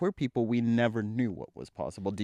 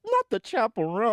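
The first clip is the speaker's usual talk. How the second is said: mock shock; 11 semitones higher, 4 dB louder than usual. Real, not acted. Mock outrage at Grammys story.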